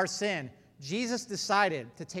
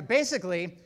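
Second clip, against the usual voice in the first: ordinary talk, nothing marked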